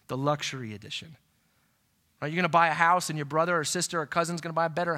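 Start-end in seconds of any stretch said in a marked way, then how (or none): 0.1-1.2 whispered aside. Acted. Envious aside about neighbor's car.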